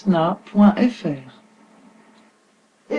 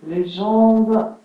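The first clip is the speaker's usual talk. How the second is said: unhurried yoga nidra guidance with long pauses; guided relaxation; 2 semitones lower, 4 dB quieter than usual. Acted. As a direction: settle listeners into stillness, phrase by phrase. (voix posée de relaxation guidée)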